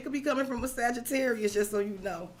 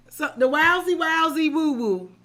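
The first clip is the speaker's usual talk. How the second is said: WOWSY belted out loud and high; excited wowsy; 6 semitones higher, 8 dB louder than usual. Real, not acted. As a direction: holler "wowsy" with glee. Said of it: "is what my oldest sister would call it".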